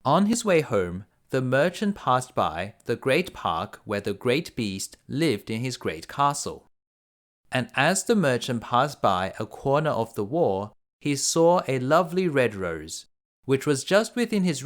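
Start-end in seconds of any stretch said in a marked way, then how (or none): none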